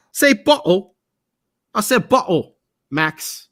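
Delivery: high, hammed-up British accent; badly faked British accent with a dropped t in bottle. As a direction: put on a cheeky mock-British accent. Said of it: "with my British accent".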